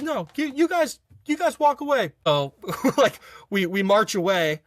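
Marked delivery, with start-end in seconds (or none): 0.0-2.1 high, halting dismissal in imitation; mock exasperated instructor. Instructor sending two recruits away, mock exasperated. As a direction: order them off in a pitched-up voice.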